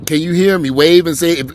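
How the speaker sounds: loud call over a car loudspeaker; over the patrol car speaker. Pretend. Officer in his patrol car calls out over the speaker, loud. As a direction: boom it out through the car speaker. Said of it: "on the loud, on the speaker".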